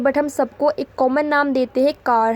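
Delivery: ordinary talk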